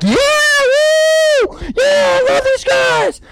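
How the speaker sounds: very high concert-crowd scream; wild excited cheering. Acted. Fan at a rock concert screaming in high excitement. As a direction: whoop like a fan on adrenaline. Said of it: "you've got that excitement".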